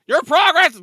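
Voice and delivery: loud exasperated outburst; berserk rage. Real, not acted. Annoyed by repeated saves.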